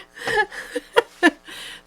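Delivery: high laugh. Real, not acted. Laugh after the sneeze moment.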